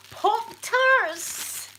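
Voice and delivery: high, loud squeal of delight; thrilled exclamation. Real, not acted. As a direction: burst out with delighted surprise. Finding a favourite treat.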